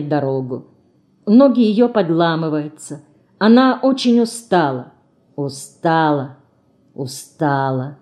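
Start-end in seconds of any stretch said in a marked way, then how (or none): 5.4-8.0 slow, low, drained repetition; weary. A narrator's reading. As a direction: let each word sink with exhaustion. Narrator echoing the heroine's exhaustion. (устало, медленно и низко)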